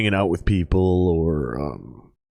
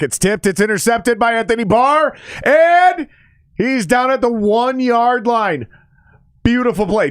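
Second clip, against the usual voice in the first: excited shout; 5 dB louder than usual. Real, not acted. Live interception call on stream.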